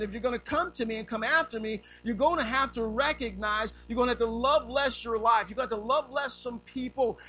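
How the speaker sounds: loud, high preaching; preaching at full force. Acted. Preacher voices Jesus to the crowd, loud and high. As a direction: drive the words home to the congregation.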